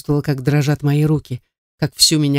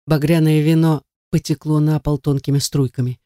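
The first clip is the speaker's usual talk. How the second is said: ordinary delivery, nothing marked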